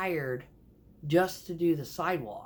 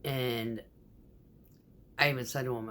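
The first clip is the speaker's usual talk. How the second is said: normal talking voice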